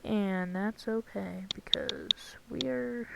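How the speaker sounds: slow soft whisper; whispered aside. Real, not acted. Slipping a disclaimer to viewers, whispered. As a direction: drop to a whisper, as if confessing.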